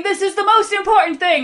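a boy insisting, hammed-up but mild; worked-up shout. Atreyu's line mimicked as an excited kid. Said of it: "he's all worked up and he's shouting".

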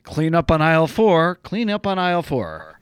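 loud mock store announcement, then easing off; store announcer.

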